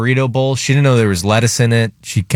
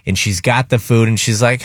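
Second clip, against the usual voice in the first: ordinary delivery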